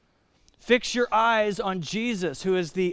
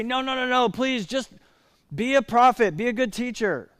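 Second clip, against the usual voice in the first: higher-pitched, hurried begging to back off; pleading protest; 6 semitones higher than usual. Acted. People objecting to Jesus's claim, pleading protest. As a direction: plead with him to settle for less.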